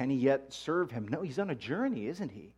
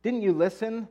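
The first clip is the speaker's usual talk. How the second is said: higher, louder, fed-up retort; exasperated man, raised pitch and voice; exasperated exclaim; 7 semitones higher, 4 dB louder than usual. Acted. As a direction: snap back at the questioners, worn out by them. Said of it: "the man exclaimed".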